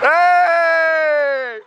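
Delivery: loud tipsy yell at cars; shout. Real, not acted. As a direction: call out to the road. Yelling at passing cars.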